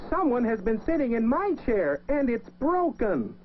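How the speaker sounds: put-on high, tiny baby bear voice; wee little voice. A narrator's reading. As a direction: squeak it out like a tiny cub. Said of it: "Baby Bear said in his wee little voice".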